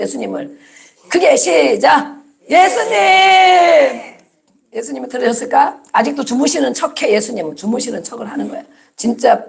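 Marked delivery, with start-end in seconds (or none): 2.5-4.2 high, long-held loud cry; desperate wake-up shout. Acted. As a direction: shout to wake him, stretching the last syllable. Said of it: "뭐라고 깨웠어요?". (다급하게 길게 외쳐 부르기)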